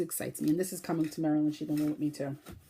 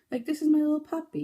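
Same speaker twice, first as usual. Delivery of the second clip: high, louder cooing voice; puppy talk; 9 semitones higher, 6 dB louder than usual. Real, not acted. Knitter presenting her toy puppy to viewers, cooing high. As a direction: gush over the little puppy.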